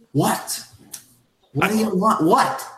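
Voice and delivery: high, indignant demands; defensive what. Acted. Hamish snapping back at the staring man, high and indignant. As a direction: fire back a puzzled what. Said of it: "He's like".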